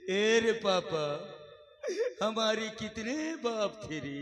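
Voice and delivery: low, slow film-villain drawl; menacing villain dialogue, dragged out low; Gabbar Singh. Acted. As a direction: play a slow, deep-pitched movie bandit. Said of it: "एक लड़के का नाम धरवा दूंगा गब्बर सिंह".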